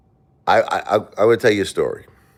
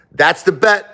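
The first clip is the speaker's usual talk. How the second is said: high, loud call of the pit boss; pit boss's call; 13 semitones higher, 6 dB louder, slower than usual. Retold or imitated. Pit boss declaring the bet to the table, high loud call. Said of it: "then he does this".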